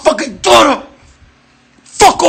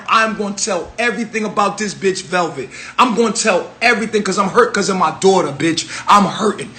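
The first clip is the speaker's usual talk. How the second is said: hurt, choked low voice; 5 semitones lower, 7 dB quieter than usual. Real, not acted. Hurt rant about his daughter.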